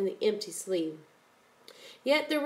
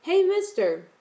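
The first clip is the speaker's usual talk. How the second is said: louder, high-pitched calling out; sudden call; 9 semitones higher, 6 dB louder than usual. A narrator's reading. Boy suddenly calls after the departing man, calling out. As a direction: call after someone walking away. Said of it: "Zebra suddenly called".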